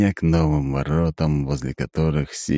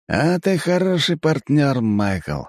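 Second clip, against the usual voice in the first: high, slow, pleased elf voice; Aiven; 9 semitones higher, slower than usual. A narrator's reading. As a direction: pat him on the back warmly. Elf praises partner.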